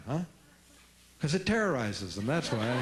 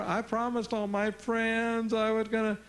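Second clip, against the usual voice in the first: put-on whiny kid; 6 semitones higher, slower than usual. Kid whining at parent.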